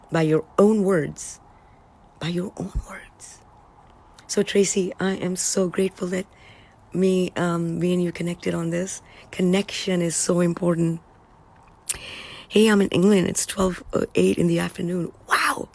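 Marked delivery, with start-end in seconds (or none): 2.2-3.4 whispered ominous echo; soft whispered "By your own words.". Shown as a demonstration. Whispered repeat of warning. 15.3-15.7 high surprised wow; high wondering "Wow."; amazed wow. Real, not acted. Reacts to being in England.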